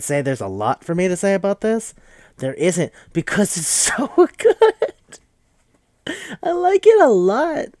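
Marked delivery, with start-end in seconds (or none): 3.1-7.7 high-pitched, laughing delight; soaring fangirl gush, louder and higher; giddy gushing. Real, not acted. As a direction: squeal it out, overcome with enthusiasm. Gushing over how good it is.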